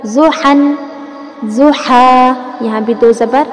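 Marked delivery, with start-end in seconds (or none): 0.0-0.5 ضحا recited, ending aa; ضحا as a recitation demo. Shown as a demonstration. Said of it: "لمبا کریں گے". 1.5-2.3 ضحا recited, ending aa; ضحا as a recitation demo. Shown as a demonstration. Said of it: "لمبا کریں گے".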